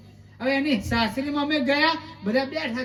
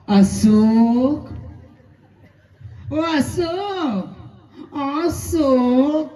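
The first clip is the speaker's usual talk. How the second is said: very slow, drawn-out sweet calling of Ashok; newlywed wife calling; 4 dB louder, much slower than usual. Acted. New bride calling her husband Ashok, slow and drawn out. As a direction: stretch "Ashok" out lovingly like a new bride.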